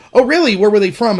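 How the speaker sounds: high, loud feigned surprise; loud mock "oh really".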